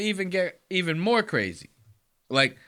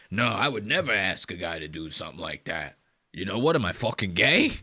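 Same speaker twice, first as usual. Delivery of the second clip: lower, softer mocking impression of the two guys; mock schmuck; 9 semitones lower, 3 dB quieter than usual. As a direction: sneer them out as dumb agreeing dudes. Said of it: "these two schmucks are gonna agree".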